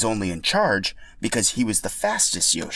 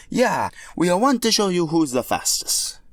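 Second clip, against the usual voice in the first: put-on much higher, louder Italian-accented Mario; 9 semitones higher, 4 dB louder than usual. Mario taunting Boshi, loud Italian accent. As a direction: boast it with Italian swagger.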